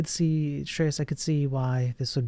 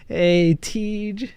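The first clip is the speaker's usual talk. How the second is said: higher and louder, with a rising call; excited greeting; 7 semitones higher, 6 dB louder than usual. Real, not acted. Greeting Teej as his raid arrives, excited and loud. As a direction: call out to him with delight.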